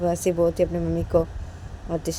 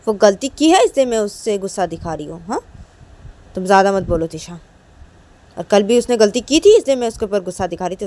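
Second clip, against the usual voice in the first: mother arguing back, higher and louder; angry mother; 4 semitones higher, 9 dB louder than usual. Acted. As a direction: defend yourself, sharp and irritated. Mother argues back angrily.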